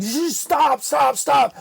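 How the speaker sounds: high, urgent plea to stop; panicked hushing. Retold or imitated. Hushing her in the meeting, panicked. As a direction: retell a frantic attempt to silence someone.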